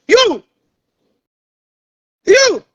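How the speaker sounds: high, sharply falling "you"; scornful jab. Real, not acted. Streamer dismissing a rival as unfit to hire, scornful. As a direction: throw out a high, derisive "you". Scoffing at the rival before laughing.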